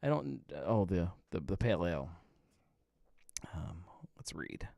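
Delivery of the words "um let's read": whisper. Real, not acted. Quiet aside before reading label.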